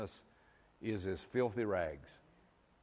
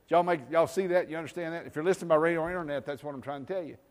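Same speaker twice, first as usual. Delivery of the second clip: louder, higher fast preacher call; calling out to the church; 6 semitones higher, 6 dB louder, faster than usual. Acted. Preacher checking the congregation follows, rousing call-out. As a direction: rouse the room, rapid-fire.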